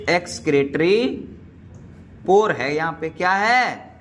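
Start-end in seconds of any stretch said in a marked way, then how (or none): 0.1-1.2 Excretory stretched out slowly, every syllable held; drawn out slowly. Shown as a demonstration. Teacher drilling the word Excretory for students, slow and drawn out. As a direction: say Excretory slowly for the class to copy.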